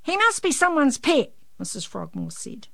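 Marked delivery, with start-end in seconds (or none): 0.0-1.3 high, projected neighbourly remark; calling out. A narrator's reading. Missus Frogmore to Janey about the rooster, calling out. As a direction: pitch it up and call it across the yard.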